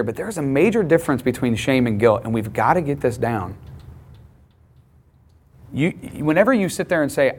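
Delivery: ordinary delivery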